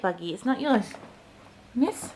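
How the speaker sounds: ordinary talk, nothing marked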